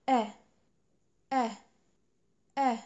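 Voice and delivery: isolated short "أ" as in "met", repeated; vowel demo. Shown as a demonstration. Teacher models the second short vowel for learners. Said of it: "تاني صوت معانا وهو".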